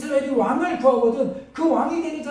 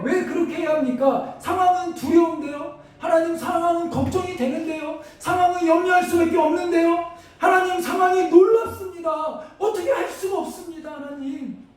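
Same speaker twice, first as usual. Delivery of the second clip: higher, fervent lament; anguished plea to God; 6 semitones higher than usual. Acted. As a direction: plead with God in distress.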